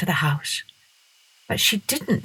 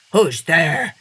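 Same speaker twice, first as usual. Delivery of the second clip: a touch of a growl, a bit louder; growling dog; 3 dB louder than usual. A narrator's reading. Dog in his kennel growling at the night visitor. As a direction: growl the challenge like a guard dog.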